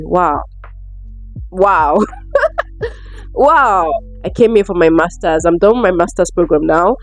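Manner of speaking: ordinary delivery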